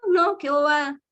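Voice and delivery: agudo y fuerte, burlón; descártalo con desdén de padre; padre despectivo. Retold or imitated. Padre invalida la tristeza del niño. (padre despectivo y burlón, más agudo y fuerte)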